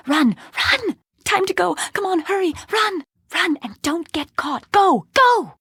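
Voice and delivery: high-pitched, urgent commands; urgent fleeing. Acted. Urging companion to flee unseen.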